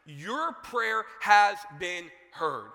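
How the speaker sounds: loud, slow, drawn-out proclamation; Gabriel. Acted. Angel announcing answered prayer to Zechariah, loud and deliberate. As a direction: announce it word by weighty word. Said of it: "what Gabriel says to Zechariah".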